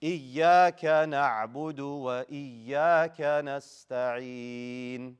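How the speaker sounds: slow chanted verse with long held endings; Quran recitation. Shown as a demonstration. Teacher recites for class to repeat, slow chanted recitation. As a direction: recite measured, stretching the final vowel.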